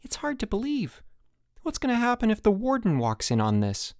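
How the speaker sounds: high-pitched, wondering disbelief; marveling. A narrator's reading. Mike watching the game with Rudy, marveling. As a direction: pitch it up high, amazed and uneasy. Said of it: "marveled Mike".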